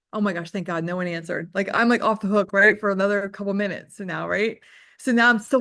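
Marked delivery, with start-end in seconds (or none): none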